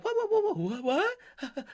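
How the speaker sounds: mock confusion, needling him; mocking what what. Mocking the threat back at the guys with a squeaky what. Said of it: "Let me stop being mean".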